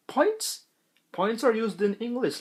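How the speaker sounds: high-pitched baffled question; acted bewilderment, pitch shooting up; incredulous. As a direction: squeak it in mock disbelief. Acts baffled reaction to decimal points.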